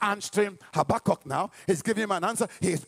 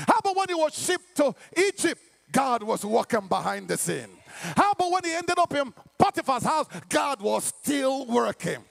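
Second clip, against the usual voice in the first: full-force preaching; 7 semitones higher than usual. Real, not acted. Preacher assuring congregation of God's presence, full-force preaching. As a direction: preach it with long, rising emphasis.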